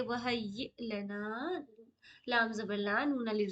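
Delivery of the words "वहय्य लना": वहय्य लना very slow with doubled य; slow call-and-repeat. Shown as a demonstration. Teacher leads students in repeating, very slow. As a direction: say वहय्य slowly so students can echo. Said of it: "मेरे साथ साथ आप लोग repeat कर सकते हैं".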